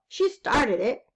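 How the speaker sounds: ordinary delivery, nothing marked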